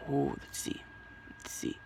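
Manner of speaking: hushed whisper. Acted. Character peeking at the popcorn in a whisper.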